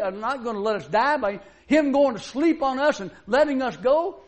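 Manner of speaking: ordinary talk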